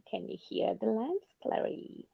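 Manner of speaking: hushed, softly spoken film quote; Silence of the Lambs. Acted. Guest quotes a favorite movie to co-host, hushed film impression. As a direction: drop to a soft, creepy near-whisper. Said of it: "the silence of, of lambs".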